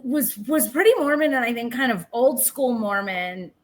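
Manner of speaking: ordinary delivery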